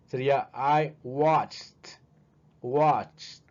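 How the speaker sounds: slow "watched" with audible T; T at the end. Shown as a demonstration. Teacher showing the T ending to students. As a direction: let the T of "watched" be heard. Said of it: "Que se escuche la T ahí al último".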